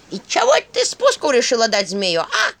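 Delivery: sharp indignant scolding; bristling. A narrator's reading. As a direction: snap at her, bristling. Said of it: "взъерепенилась Черепуша".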